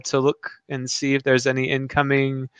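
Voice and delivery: ordinary talk, nothing marked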